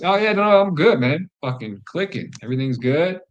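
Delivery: over-bright I'm-good-man voice; fake cheer. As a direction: hide the turmoil behind a bright grin. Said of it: "and I'm just in emotional turmoil".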